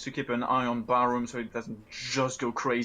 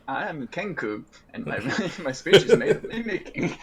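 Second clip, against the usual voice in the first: giggling character line; laughing through it; 4 semitones higher, 6 dB louder than usual. Retold or imitated. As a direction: laugh while saying it. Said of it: "I'm just imagining Squeak saying".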